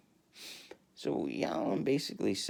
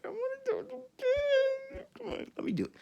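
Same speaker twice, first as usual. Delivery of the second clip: mock whine. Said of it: "I just can't do it".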